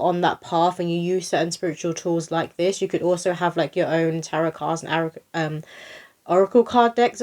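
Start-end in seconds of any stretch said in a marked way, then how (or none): 6.3-7.2 louder, higher, catching the slip; blurted correction. Real, not acted. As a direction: push the words out over the wrong one. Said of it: "I was about to say arrow".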